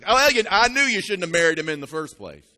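loud told-you-so mother voice; nagging mom. Retold or imitated. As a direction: scold like a mama who knew it.

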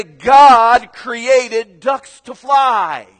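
booming, drawn-out preacher proclamation; slow, stretched-out pulpit shout; duck preacher. Acted. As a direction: proclaim it loud and high like a fired-up preacher. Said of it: "he would begin to preach his message".

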